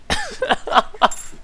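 squealing high laugh. Real, not acted. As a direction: crack up in a squeal. Laughing fit.

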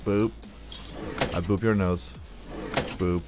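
normal talking voice

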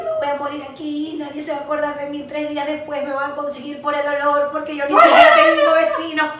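retold panic, played for laughs; mock panic. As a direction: build to a loud comic wail. Acts out her panicked catastrophizing.

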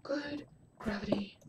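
slow, very soft whisper; hushed whisper. Real, not acted. Gamer after a zombie encounter, hushed whisper. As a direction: breathe it out slowly in a whisper.

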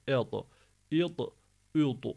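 "Ed, id, üd" dark, each D rebounding; thick qalqalah. Shown as a demonstration. Teacher drilling students, thick echoed "Ed, id, üd". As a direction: make each D heavy and echo it. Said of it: "Kalın ve kalgaleli".

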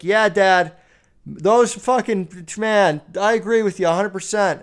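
fake enthusiastic agreement. Son gaming, shouting fake agreement to his dad from his room. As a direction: overplay agreeing while distracted. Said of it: "and I'm like".

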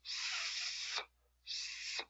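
"s" as a long voiceless hiss with no vowel; emphatic s sound. Shown as a demonstration. Teacher showing the sad sound as a bare soft hiss. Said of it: "So this is the sound".

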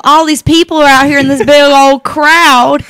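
put-on country accent. Disciple voice continues, country style.

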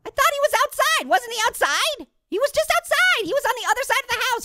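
high, fast disbelief; can't believe he's inside; shocked disbelief. Real, not acted. Player shocked the neighbor got inside.

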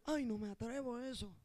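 mock a scared churchgoer; quiet mock timid whine; timid excuse. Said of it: "gente que tú dices".

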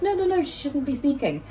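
high, urgent doctor stopping the chatter; a hint of an alarmed doctor; doctor's urgent protest. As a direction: voice a flustered doctor halting her. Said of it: "the doctor came over and was like".

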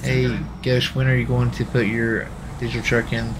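ordinary delivery

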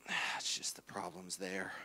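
low, hushed whispery groan; acted low, breathy fretting; strained mutter. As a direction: mutter it under your breath, fixated. Acts out staring at problem.

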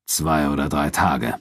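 the wary man growls his answer slowly. A narrator's reading. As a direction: growl it out unwillingly. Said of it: "knurrte Laurus".

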